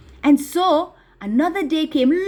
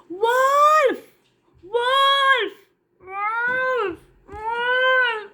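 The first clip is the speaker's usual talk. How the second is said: put-on a bored shepherd boy crying wolf for a trick; false alarm cry; 11 semitones higher, much slower than usual. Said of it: "he started to cry".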